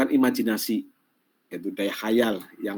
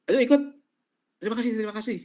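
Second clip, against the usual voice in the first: polite refusal; 11 semitones higher, much faster than usual. Shown as a demonstration. Acted invite and polite refusal. (ajakan riang lalu tolakan halus)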